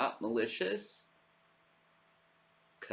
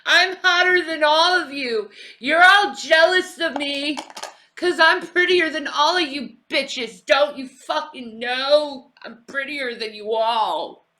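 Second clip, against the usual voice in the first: high, loud catty diva bragging; 12 semitones higher, 11 dB louder than usual. Retold or imitated. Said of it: "That sounds like Effie".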